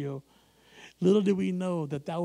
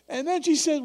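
usual speaking voice

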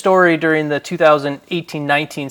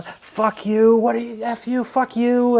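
imitating a rival player's jeers, pitched higher; higher, mocking shouts thrown down the hallway; mock yell; 6 semitones higher, 3 dB quieter, slower than usual. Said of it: "he's yelling down".

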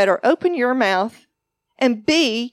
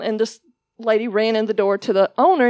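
normal talking voice

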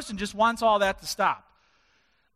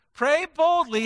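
emphatic call; 8 semitones higher, 5 dB louder than usual. Shown as a demonstration. Preacher repeats it, now punched.

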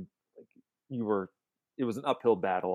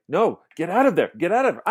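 louder, higher mock panic at the screen; alarmed viewer pleading; 6 semitones higher, 7 dB louder than usual. Film fan reliving a scene he knows too well, mock-alarmed pleading. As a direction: urge the film character to escape, half in earnest.

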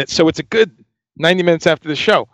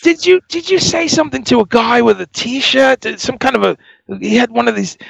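high-pitched, halting English accent imitation; 6 semitones higher than usual. Boss questions musician about T-shirt incident, bad English accent. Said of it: "sorry for the bad English accent".

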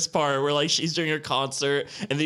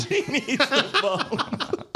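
slow, higher, through laughter; laughing; 12 semitones higher, much slower than usual. Real, not acted. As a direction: repeat it while cracking up. Echoing line while laughing.

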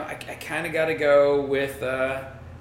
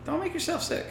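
much higher, nagging-parent singsong; mock nagging mom; 4 dB quieter than usual. Warning his drinking co-host, mock motherly nag.

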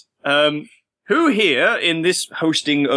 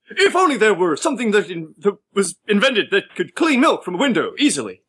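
higher-pitched, overwrought infomercial plea; hammy infomercial Shatner; 5 semitones higher than usual. Pretend. Host answering the infomercial cue, hammed-up plea. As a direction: overact the helpless wish. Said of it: "Man, I love Shatner".

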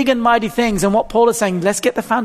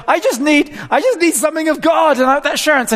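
higher, urgent retold plea; pleading retold; 5 semitones higher than usual. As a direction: beg for assurance, voice lifted.

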